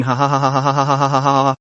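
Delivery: long teasing ha ha laugh; mocking laugh. A narrator's reading. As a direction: laugh it up at her expense.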